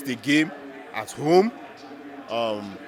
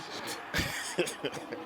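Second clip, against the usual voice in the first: soft laugh; 12 dB quieter than usual. Real, not acted. Laughs at United's turmoil.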